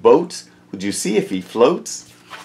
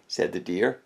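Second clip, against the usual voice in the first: plain reading voice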